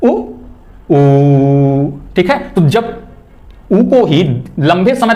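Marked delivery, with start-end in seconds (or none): 0.9-1.9 ऊ held long and steady. Shown as a demonstration. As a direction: stretch the ऊ out. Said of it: "जैसे ऊ". (ऊ को लंबा खींचकर)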